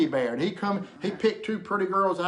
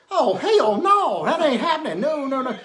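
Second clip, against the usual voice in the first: alarmed refusal; 8 semitones higher, 8 dB louder than usual. Retold or imitated. Drunk man refuses the leprechaun's offer, loud and alarmed. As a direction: back away in mock horror.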